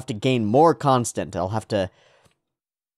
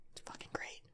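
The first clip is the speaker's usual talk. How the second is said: whisper; 24 dB quieter than usual. Real, not acted. Whispered aside.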